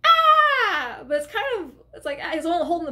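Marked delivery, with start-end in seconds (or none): 0.1-1.0 loud high scream; retold scream, full volume. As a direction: let out a sudden terrified scream. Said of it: "Jamie screams".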